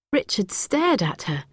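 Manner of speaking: ordinary delivery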